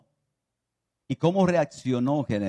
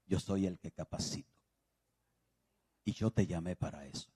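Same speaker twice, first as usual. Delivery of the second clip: much softer, low prayerful voice; soft, low retelling of a private prayer; hushed retelling; 5 semitones lower, 13 dB quieter than usual. Retold or imitated. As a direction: drop to an intimate hush. Retells quiet dawn prayer, inner voice.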